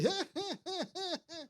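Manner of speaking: high shoulder-shaking chuckle; high imitated chuckle; imitated laugh. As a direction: mimic a friend's silent shoulder laugh. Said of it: "Y él se reía así".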